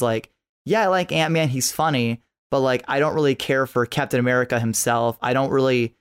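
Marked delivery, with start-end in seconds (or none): none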